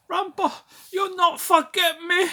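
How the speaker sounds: very high, loud mock voice; hammed-up piercing cry in falsetto; Co from Rambo. Dying Co pleads with Rambo, hammed-up high, loud impression. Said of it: "Co, is it?".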